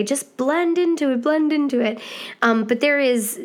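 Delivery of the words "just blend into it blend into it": mock teacher advice. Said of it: "but they'll just say".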